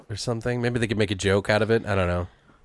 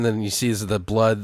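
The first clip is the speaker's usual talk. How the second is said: ordinary talk, nothing marked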